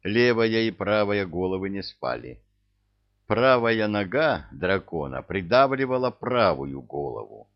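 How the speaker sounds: ordinary delivery, nothing marked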